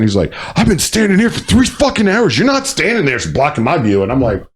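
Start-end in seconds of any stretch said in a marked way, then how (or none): 0.6-4.0 high-pitched mock outrage; pitched-up retold rant; retold angry yell. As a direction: imitate an indignant stranger's complaint. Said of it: "he started yelling at me".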